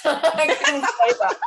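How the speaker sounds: loud, high, slowed by real laughter; laughing. Real, not acted. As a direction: laugh right through the words. Laughs at her own remark.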